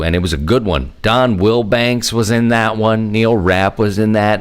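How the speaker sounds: ordinary talk, nothing marked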